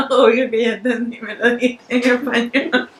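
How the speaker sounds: laughing. Real, not acted. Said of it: "laughing while speaking".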